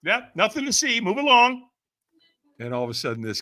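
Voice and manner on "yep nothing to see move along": high-pitched pretend reassurance; falsely breezy. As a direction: brush off concern with feigned cheer. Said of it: "I'm gonna lie about it".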